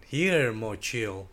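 ordinary delivery

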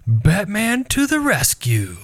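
put-on high, slow heroic kid voice; kid superhero. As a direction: announce it like a little superhero. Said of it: "And she'd be like".

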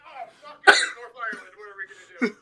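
very loud, high laughing panic; panicked shriek. Acted. Government voiced in panic over Northern Ireland, screaming and laughing. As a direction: scream it in mock despair.

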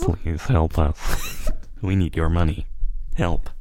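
lightly voiced robot calling from a well; robot trapped in a well. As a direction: plead like a robot at the bottom of a well. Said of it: "a robot trapped in a well".

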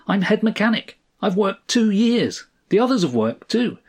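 high-pitched, worked-up protest; heated, insistent outburst; angry shouting. A narrator's reading. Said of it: "shouted the man".

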